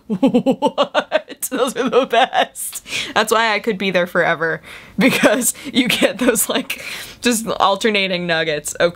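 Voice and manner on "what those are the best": laughing disbelief. Real, not acted. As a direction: giggle through it. Laughing at creepy posts.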